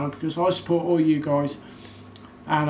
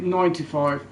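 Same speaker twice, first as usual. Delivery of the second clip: normal talking voice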